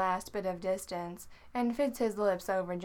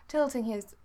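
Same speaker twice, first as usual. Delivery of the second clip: usual speaking voice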